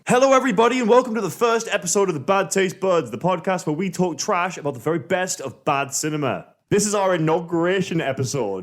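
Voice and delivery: high-pitched, upbeat host greeting; big welcome that eases down slightly; podcast intro. Acted. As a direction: launch the show like a hyped-up host. Podcast host performs show intro.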